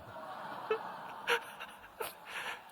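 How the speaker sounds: long squealing breathy laugh; giggling squeal; high laugh. Real, not acted. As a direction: laugh at your own confession. Laughs about praying for wife.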